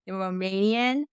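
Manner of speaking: ordinary talk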